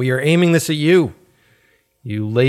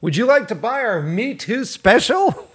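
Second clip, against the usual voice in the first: much higher, chirpy sales pitch; mock ad-agency salesman; 11 semitones higher than usual. Ad agency selling to brands, a mock chirpy sales pitch. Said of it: "same company that was like".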